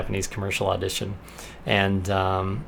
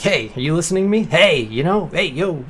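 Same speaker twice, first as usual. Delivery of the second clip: imitated casual call-out, higher pitched; trying to get attention; 5 semitones higher than usual. Guy auditioning calls to the girl, attention-seeking. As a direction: call to her as if she's ignoring you, lightly acted. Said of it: "tries to kinda be like".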